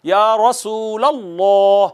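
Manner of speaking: या रसूलल्लाह with a full, heavy लाम, drawn out; slow demo of या रसूलल्लाह, the lam thick. Shown as a demonstration. Teacher showing students the heavy lam, drawn out slowly. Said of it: "लाम के ऊपर ज़बर है तो पढ़ेंगे".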